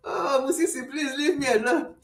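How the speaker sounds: high plea through laughter; real laughing fit, high-pitched; helpless laughter. Real, not acted. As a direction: beg off, giggling helplessly. Helpless laughter at friend's joke.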